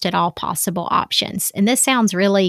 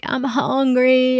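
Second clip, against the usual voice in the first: mock whine; 5 semitones higher, slower than usual. Daughter whining on the floor.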